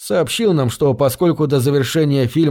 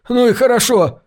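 yelling; 9 semitones higher than usual. Acted. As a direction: burst out yelling, higher. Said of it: "вдруг заорал он".

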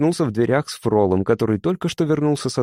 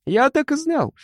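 much higher, jumping triumphant yell. A narrator's reading. Mishka yells at Frol, bursting with triumph, much higher. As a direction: leap up and howl it. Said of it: "заорал Мишка и даже подпрыгнул".

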